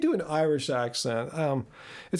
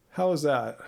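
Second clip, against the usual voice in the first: ordinary talk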